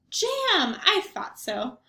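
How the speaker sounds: a cheerful, half-deaf grandmother greeting her guest; Baba Brena. Acted. Grandmother greeting the rabbi at her door, chirpy old lady voice.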